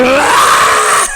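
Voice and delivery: loud high rah of pain; pained roar. Retold or imitated. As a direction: bark out rah as if stabbed. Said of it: "It's like".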